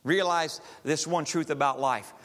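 usual speaking voice

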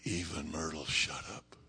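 low, soft, hushed storytelling; hushed awe. A narrator's reading. Preacher telling how even Myrtle fell silent, hushed. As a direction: drop right down low and quiet.

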